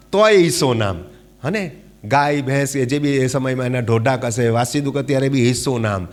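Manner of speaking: ordinary delivery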